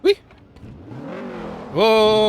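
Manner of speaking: ordinary talk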